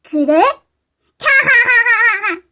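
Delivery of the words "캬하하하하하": evil villain cackle. Acted. Name thief reveals self.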